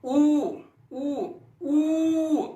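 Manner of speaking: ऊ held long with rounded lips; demonstrating long u. Shown as a demonstration. Teacher sounding out ऊ for the class. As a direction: draw out ऊ slowly. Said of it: "ऊ को क्या करते समझते हैं".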